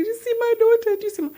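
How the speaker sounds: high, loud, tearful gushing; high-pitched weepy wail; mock crying. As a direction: act out weepy, overwhelmed pride in a rush. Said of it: "I started crying".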